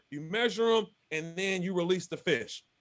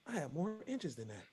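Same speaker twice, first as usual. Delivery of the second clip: much softer, almost to himself; private realization; 4 semitones lower, 14 dB quieter than usual. Retold or imitated. Angler comparing a friend's award, hushed realization. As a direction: say it under your breath, working it out. Doing the math in his head.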